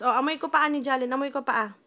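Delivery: normal talking voice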